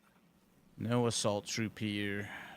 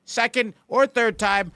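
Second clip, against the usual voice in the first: loud, high, hammed-up furious yell; mock upset yelling; 14 semitones higher, 14 dB louder than usual. Shown as a demonstration. Streamer demonstrating what upset sounds like, loud mock yell. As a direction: scream it like a betrayed partner. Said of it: "Upset would be like".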